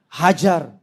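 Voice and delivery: low-pitched, lightly menacing order; mock stern command. God imagined ordering angels to strike, low mock command. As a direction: drop low for a curt command.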